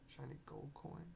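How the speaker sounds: plain reading voice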